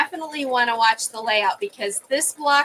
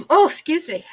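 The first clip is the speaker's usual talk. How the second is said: higher and louder right after a sneeze; just sneezed; 6 semitones higher, 6 dB louder than usual. Real, not acted. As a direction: catch your breath after sneezing.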